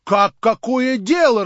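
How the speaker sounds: loud, high, drawn-out outcry; indignant exclamation. A narrator's reading. Crowd reacts to Stavrogin's indifference, exclaiming loudly. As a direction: burst out slowly and loudly in outrage. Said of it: "раздались восклицания".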